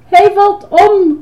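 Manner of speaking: high, loud, drawn-out cry of alarm; sudden shriek. A narrator's reading. Girl sees the tower falling, sudden shriek. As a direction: shriek a warning, slowly stretched. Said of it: "gilde Betty plotseling".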